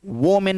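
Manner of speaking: वोमेन said "wo-min" with an O; wrong pronunciation. Shown as a demonstration. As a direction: give the first vowel of वोमेन an O sound, wo-min. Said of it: "नहीं होगा".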